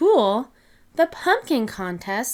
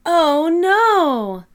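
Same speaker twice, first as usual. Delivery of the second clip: loud, high alarmed cry; dismayed oh no; 7 semitones higher, 7 dB louder than usual. A narrator's reading. Narrator reacting to the stuck pumpkin, with loud dismay.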